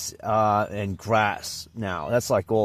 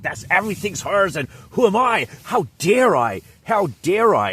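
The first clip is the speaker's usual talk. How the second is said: higher, louder entitled outrage; mock indignant Karen; 9 semitones higher, 6 dB louder than usual. Mocking the Karen who thinks everything is hers, higher and louder. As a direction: ham up the offended neighbour. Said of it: "She's like".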